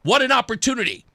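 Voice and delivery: high-pitched retold exclamation; wowed by sudden luck; excited realization. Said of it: "I'm saying to myself".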